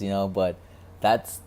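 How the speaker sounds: ordinary talk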